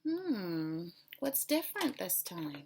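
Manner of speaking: put-on expressive read-aloud for kids; storybook reading. A narrator's reading. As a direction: read it like a picture book to children. Teacher reads storybook to class.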